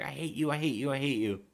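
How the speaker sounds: retold high-pitched tantrum; mock hateful outburst. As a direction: imitate her repeating it as she lashes out. Acts her slapping and hating him.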